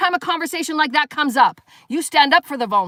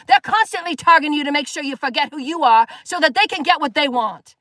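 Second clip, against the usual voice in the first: usual speaking voice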